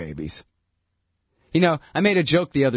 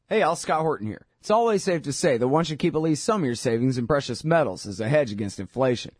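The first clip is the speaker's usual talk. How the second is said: softer, steady sponsor read; 3 semitones lower, 4 dB quieter than usual. Acted. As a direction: read the ad calmly and smoothly.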